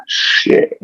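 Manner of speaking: a breathy whispered curse of regret. Retold or imitated. Realising the words just left your mouth, whispered curse. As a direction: whisper it as it slips out. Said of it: "you're like".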